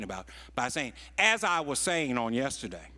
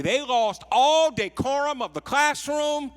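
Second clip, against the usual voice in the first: swelling into a loud, much higher preacher's cry; preaching at full force; 10 semitones higher, 9 dB louder than usual. Acted. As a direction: build the congregation up to a roar. Preacher builds to a climax.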